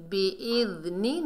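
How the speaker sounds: बिइज़्नि with gentle dh, not z; soft dhal. Shown as a demonstration. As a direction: say बिइज़्नि as "bi-idh-ni". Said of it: "नरमी से अदा करना है जाल को".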